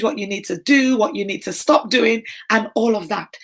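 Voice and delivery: ordinary talk, nothing marked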